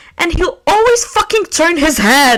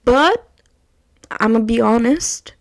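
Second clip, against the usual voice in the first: usual speaking voice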